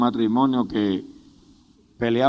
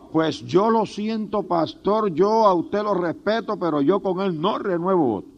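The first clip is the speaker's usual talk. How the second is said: insistent refusal; 5 semitones higher than usual. Retold or imitated. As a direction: refuse the pastor respectfully but stubbornly, voice raised in pitch. Wife firmly refusing the pastor, acted.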